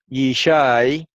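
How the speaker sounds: ordinary talk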